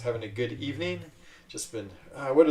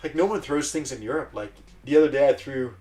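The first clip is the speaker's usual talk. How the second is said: usual speaking voice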